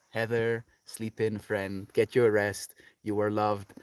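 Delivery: normal talking voice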